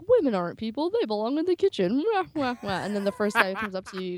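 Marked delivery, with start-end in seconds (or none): 0.1-2.7 loud, high jerk voice; mocking a jerk. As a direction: taunt like a sexist bro.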